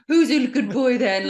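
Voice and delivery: high, loud pet talk; cooing dog-owner voice. Pretend. Investigator greeting a puppy among demons, cooing. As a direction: gush at a puppy, playfully hammed up.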